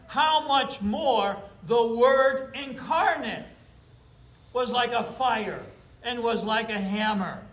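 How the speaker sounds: preaching at full force. Acted.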